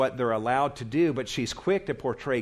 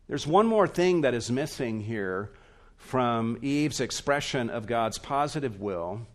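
normal talking voice

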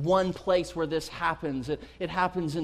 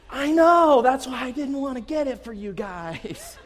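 high, wailing mock exasperation; exasperated dad; 6 semitones higher, 4 dB louder than usual. Dad answering disgusted kids, exasperated. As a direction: burst out as the vindicated parent.

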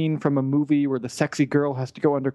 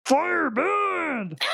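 announcer voice; 14 semitones higher, much slower than usual. Pretend. Mock dramatic announcer intro.

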